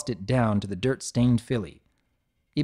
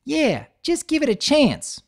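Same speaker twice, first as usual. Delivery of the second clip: pleading; 4 dB louder than usual. A narrator's reading. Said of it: "Apple Bloom pleaded".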